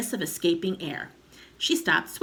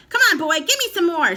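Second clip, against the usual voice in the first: very loud high screech; grandma yelling for more medicine; 12 semitones higher, 11 dB louder than usual. A narrator's reading. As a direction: bellow it out greedily. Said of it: "she yelled".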